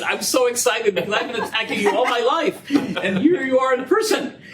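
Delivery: gleeful retold greeting through laughter; half-laughing, tickled to meet him; laughing while quoting herself. Telling a Chicago economist she's excited to meet him, laughing.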